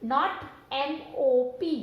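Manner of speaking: slow, spelled out letter by letter, not एम ओ पी; wrong spelling contrast. Shown as a demonstration. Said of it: "spelling बनती है एम ए पी". (गलत spelling धीरे धीरे)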